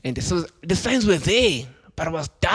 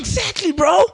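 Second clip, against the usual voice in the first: loud agreement; 13 semitones higher, 4 dB louder than usual. Real, not acted. Agreeing loudly with friend.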